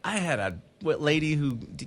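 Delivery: normal talking voice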